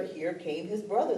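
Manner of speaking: ordinary delivery, nothing marked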